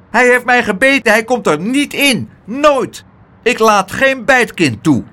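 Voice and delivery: high, loud, furious little doorman; indignant tiny gatekeeper, emphatic on every word; outraged. A narrator's reading. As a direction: refuse entry in a high angry cry. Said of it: "werd hij vuurrood van ergernis".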